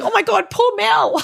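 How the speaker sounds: very high, hammed-up dismay; mock shocked sympathy. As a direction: squeal it in pitying shock, half joking. Acts out her shocked sympathy.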